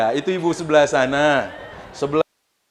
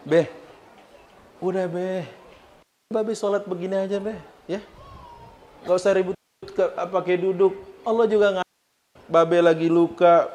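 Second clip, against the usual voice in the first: a hint of a Betawi kid coaxing his father; Betawi son; 3 semitones higher than usual. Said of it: "Kalau bahasa saya ini orang Rawamangun begini".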